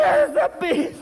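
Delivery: high, loud alarmed shout; panicked yell. Retold or imitated. Son yells to his mother about the bee, high panicked shout. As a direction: shriek the warning. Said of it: "I say".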